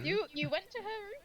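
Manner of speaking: surprised. Real, not acted. Jealous surprised question.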